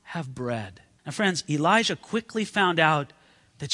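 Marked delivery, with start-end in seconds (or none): 0.0-0.8 slow, softer, hint of grief; heartbroken. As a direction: say it slowly like a hopeless widow. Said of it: "this heartbreaking line".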